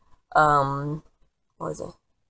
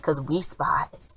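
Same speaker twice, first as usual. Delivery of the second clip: whispered, slow confession; whispered secret; 5 dB quieter, much slower than usual. Retold or imitated. Grandparents revealing to kids, a slow whisper.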